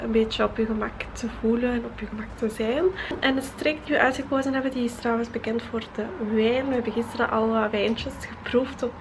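ordinary delivery

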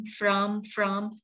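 from with F joined straight to R; F blended onto rom. Shown as a demonstration. Teacher models from for learners, F and R blended. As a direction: blow the F into rom for from. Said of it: "Nói âm phờ vô là from".

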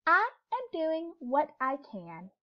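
a hummingbird answering simply and steadily; gentle reply. A narrator's reading. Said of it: "She said".

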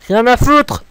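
loud, high outburst; high-pitched, dismissive yell; fed-up shout. Real, not acted. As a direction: shout it out, sick of bothering. Fed up with the build.

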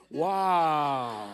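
a loud, astonished "Wow"; a preacher marvelling at the new campus; amazed wow. Real, not acted. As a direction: let the wonder burst out.

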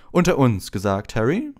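loud, patronising confidence; condescending, rising on the name; fatherly Lockhart. A narrator's reading. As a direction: confide like a self-important uncle. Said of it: "sagte Lockhart väterlich".

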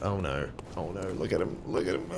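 low, very soft aside; hushed dread. Real, not acted. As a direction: murmur it warily under the breath. Wary aside about enemy.

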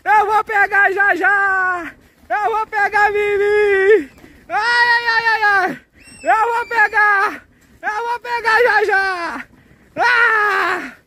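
high, stretched-out monster shout; chasing dinosaur. Pretend. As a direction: wail each threat slowly as a playful dinosaur. Plays the dinosaur chasing children. (voz aguda de dinossauro perseguindo as crianças)

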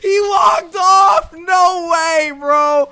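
high, loud, slow wailing no; anguished yelling. Real, not acted. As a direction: wail in despair as he escapes. Enemy escapes, anguished.

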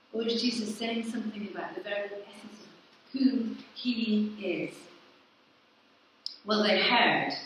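usual speaking voice

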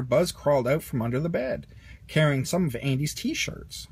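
ordinary delivery

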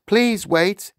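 high, loud pleading schoolboy; flustered. A narrator's reading. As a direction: beg the teacher for time. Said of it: "Poor Wilfred was properly, prob-, properly flustered".

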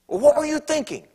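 mock judgmental wife. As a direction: scold like a disapproving spouse. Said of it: "Speak with a judgmental spirit".

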